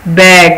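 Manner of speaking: بیگ said firmly with a short a; modelled word. Shown as a demonstration. Teacher naming the picture بیگ for children, clearly. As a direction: announce بیگ clearly for the class. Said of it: "صحیح spelling دیکھتے ہیں".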